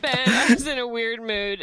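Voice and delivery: usual speaking voice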